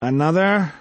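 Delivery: tired waitress, flat and nasal. A narrator's reading. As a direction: drone it through the nose, worn out. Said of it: "in a flat, nasal voice".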